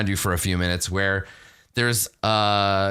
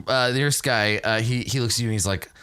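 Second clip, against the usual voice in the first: usual speaking voice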